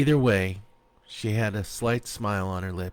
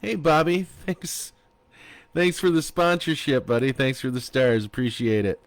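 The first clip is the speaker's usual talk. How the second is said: cheerful thanks; 5 semitones higher, 4 dB louder than usual. Real, not acted. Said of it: "reader thanks a sponsor".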